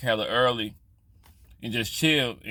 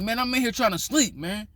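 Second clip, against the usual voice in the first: raised, higher complaint of being woken; irritated complaint; 9 semitones higher than usual. Retold or imitated. Woken speaker scolding the old barber, irritated and raised. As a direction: let him have it, fed up.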